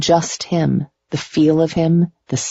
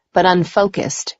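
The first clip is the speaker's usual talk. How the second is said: ordinary delivery, nothing marked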